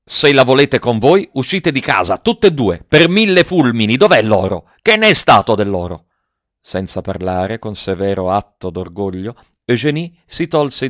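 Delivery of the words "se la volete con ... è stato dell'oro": loud, higher ranting father; furious cooper scolding wife and daughter; angry old miser. A narrator's reading. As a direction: bark orders at the household.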